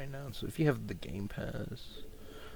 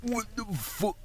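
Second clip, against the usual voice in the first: high-pitched disbelief; startled, high-pitched swearing; real surprise; 11 semitones higher, slower than usual. Real, not acted. Sudden surprise during the game.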